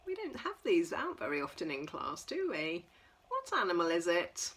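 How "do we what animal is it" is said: usual speaking voice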